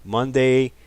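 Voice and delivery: normal talking voice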